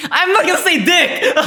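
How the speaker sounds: high, loud squeal of laughter; laughing so hard the voice shoots up; cracking up. Real, not acted. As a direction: shriek it through helpless laughter. Cracks up at own dirty joke.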